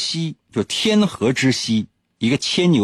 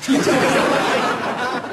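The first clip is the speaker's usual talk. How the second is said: falling high laugh; bright high laugh; high giggle; 13 semitones higher than usual. Real, not acted. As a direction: giggle at the punchline. Laughs at own joke.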